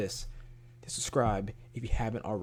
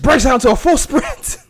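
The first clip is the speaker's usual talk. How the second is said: loud amused outburst; 13 semitones higher, 14 dB louder than usual. Real, not acted. YouTuber describing a sudden chase, loud and amused. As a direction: voice rising high and loud into laughter. Excited telling of the footage.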